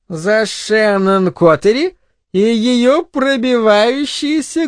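slow woman's voice, swinging high; tipsy crude mother. Acted. Drunk mother toasts son's girl, slowly taunting. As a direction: draw out the mocking toast, ending high.

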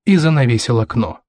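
usual speaking voice

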